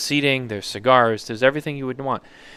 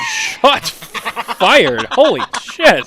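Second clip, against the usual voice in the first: high, loud, astonished exclamation; real shocked outburst; 9 semitones higher, 7 dB louder, much slower than usual. Real, not acted. Host reacting to a guest's sudden insult, shocked outburst. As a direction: cry out in amused shock at the jab.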